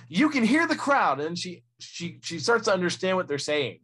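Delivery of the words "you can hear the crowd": much higher, louder reporter voice; imitating the reporter. Podcast host mimicking the pit reporter hearing the crowd, high-pitched imitation. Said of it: "you can hear in her voice".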